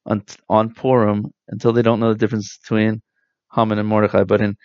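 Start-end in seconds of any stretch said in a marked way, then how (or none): none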